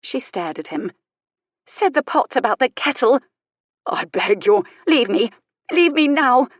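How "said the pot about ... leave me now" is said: higher, louder, building indignation; acted outrage, growing louder; outraged. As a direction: indignantly order someone away, rising in pitch. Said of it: "shoulders heaving in outrage".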